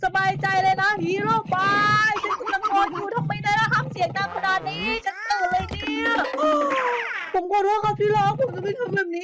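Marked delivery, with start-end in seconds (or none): 6.2-7.3 sharp startled gasp. Acted. Wife startled by husband. (หายใจเฮือกดังด้วยความตกใจ)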